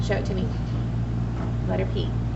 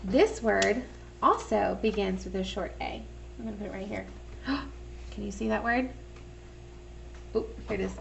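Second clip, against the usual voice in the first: quiet; 9 dB quieter, faster than usual. Real, not acted. Teacher drops voice.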